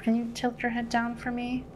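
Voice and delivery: ordinary delivery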